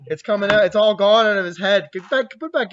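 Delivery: loud, high mock panic; teasing a friend, loud and high; mock alarm. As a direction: fake shock at his hair.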